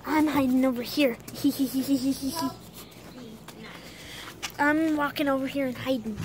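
quiet hiding voice, humming softly; hushed. Real, not acted. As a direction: keep it hushed so nobody hears. Hiding quietly during hide and seek.

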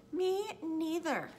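high, slow little joey voice; young kangaroo. A narrator's reading. Young kangaroo echoing mother from her pouch, high piping voice. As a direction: chime in high and drawn out.

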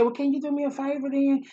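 a hint of the friend asking sweetly; mild put-on voice for the friend's favor; quoting her friend. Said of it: "She said".

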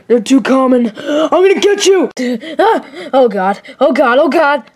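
flustered and panicky; panicked chase. Acted. Gengar being chased, half-laughing panic.

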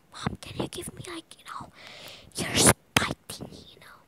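hushed, confiding request; secretive whisper. Pretend. Child asking mom for the spike thingy, whispering. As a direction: whisper it so the others miss it.

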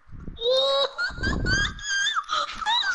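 long, very high laugh; high squealing laugh. Real, not acted. Laughing at Darth on the hoverboard, high squeal.

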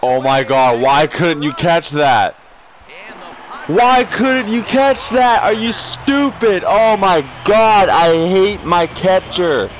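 high, loud furious rant at the catcher; furious yelling. Real, not acted. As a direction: scream it at your useless catcher. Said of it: "that really pissed me off".

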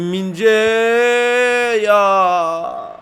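long, loud wail of protest; drawn-out protest. Real, not acted. Gamer to his defender as a goal threatens, drawn-out wail. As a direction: wail it out, stretching the name. Concedes danger, wails at defender.

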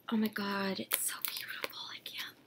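hushed, breathy whisper of awe; awed whisper. Real, not acted. Collector staring at the swimsuit, whispering in awe. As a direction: breathe it out in wonder.

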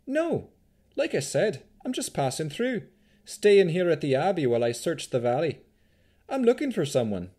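put-on high Vistani accent, brisk denial; high-pitched accented adventurer; jaunty Vistani swashbuckler. A narrator's reading. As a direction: brush them off in a lilting accent.

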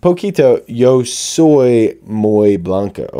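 high start, then slow, drawn-out Spanish; hammy gringo Spanish. Retold or imitated. Retelling the joke that got a laugh, hammy Spanish. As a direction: deliver the Spanish punchline slowly. Re-enacts his joke to locals.

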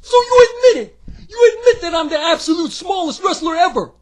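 high, loud gleeful puppet yell; triumphant puppet shout. Acted. Puppet gloating at his critic, high triumphant shout. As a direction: crow in victory as a tiny puppet.

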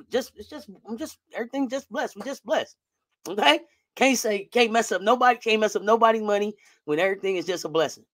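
hammed-up humble-brag; mock teammate praise. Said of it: "they like".

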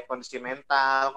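usual speaking voice

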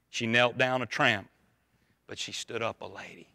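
soft, low, sincere closing line; tender close. Real, not acted. Preacher closing the testimony softly and sincerely. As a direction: let it land gently.

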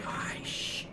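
very soft whispered shushing; imitated hush. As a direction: mimic someone hushing a companion. Voicing the hushing game companion.

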